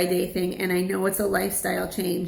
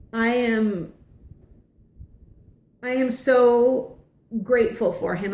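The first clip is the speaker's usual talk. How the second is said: close to tears; 3 semitones higher than usual. Real, not acted. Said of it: "it's making me wanna cry now".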